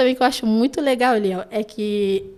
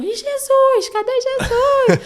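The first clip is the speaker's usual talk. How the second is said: put-on high, loud child voice, slower on the second; puzzled kids; 14 semitones higher, 5 dB louder, much slower than usual. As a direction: imitate children searching for Jesus. Children asking in Bible class.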